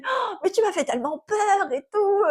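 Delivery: high-pitched gasp of maternal fright, re-enacted; imitated worried mother. Mother tells daughter how scared she was, imitated high worried voice.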